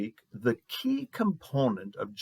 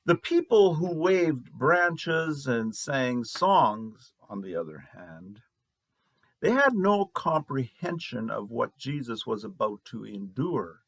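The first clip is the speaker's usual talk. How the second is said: usual speaking voice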